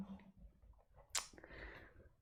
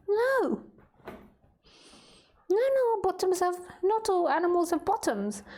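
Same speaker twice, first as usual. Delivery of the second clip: put-on high, sing-song baby talk to a pet dog; 8 semitones higher than usual. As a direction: coo at the puppy in a high voice. Baby-talk to her dog Khaleesi.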